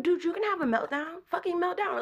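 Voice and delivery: exasperated parent. Retold or imitated. Retold permission to child, exasperated.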